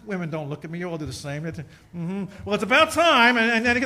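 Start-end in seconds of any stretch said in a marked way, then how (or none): 2.4-3.4 mock wife. Wife's retort imitated.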